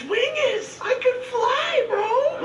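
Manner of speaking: high, laughing squeal. Real, not acted. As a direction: giggle through it with joy. Laughing at huge wing.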